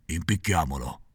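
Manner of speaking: a low muttered echo; echoing second assassin. A narrator's reading. Second assassin repeating, low and soft. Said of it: "ripeté l'altro".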